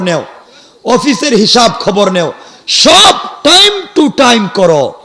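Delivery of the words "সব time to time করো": slow, high, stretched-out emphasis; emphatic preaching. Real, not acted. Preacher capping a list of daily checks, emphatic. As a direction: lean hard and slowly on each word.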